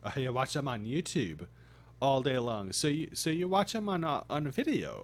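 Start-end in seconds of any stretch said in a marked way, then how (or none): none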